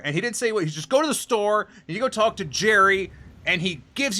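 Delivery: mock a know-it-all insisting; insistent. Customer telling staff how easy shipping is, insistent.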